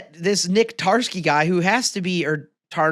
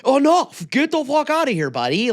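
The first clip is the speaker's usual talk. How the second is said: retold yell; 7 semitones higher than usual. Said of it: "he's like".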